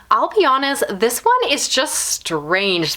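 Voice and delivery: ordinary talk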